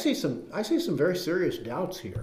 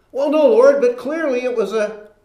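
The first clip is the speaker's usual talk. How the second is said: higher, much louder flustered protest; flustered pleading; 7 semitones higher, 11 dB louder than usual. Pretend. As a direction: blurt it out, caught in the wrong before God. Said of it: "answering God at judgment".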